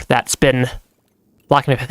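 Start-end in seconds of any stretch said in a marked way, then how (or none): none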